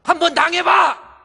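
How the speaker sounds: very high, very loud scream; vengeful shout. Retold or imitated. Daughter-in-law telling sisters-in-law to suffer it, vengeful scream. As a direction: scream it at the in-laws as a curse. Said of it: "이렇게 소리 지를 일은 아닌데".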